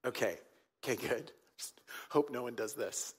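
ordinary talk, nothing marked

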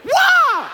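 high loud karate kiai; sharp high karate yell; karate shout. Shown as a demonstration. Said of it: "So it's like".

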